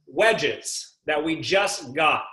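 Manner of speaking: drawn-out, slow sales pitch; slow salesman pitch. Retold or imitated. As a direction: stretch it out like a pushy salesman. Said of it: "And the guy says".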